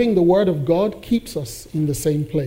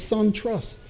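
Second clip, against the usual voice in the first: quiet tender voice; 2 semitones higher, 10 dB quieter than usual. Retold or imitated. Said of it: "He says".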